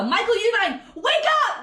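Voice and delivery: loud, high-pitched rebuke to someone absent; exasperated calling out. Pretend. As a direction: shout it up high like a wake-up call.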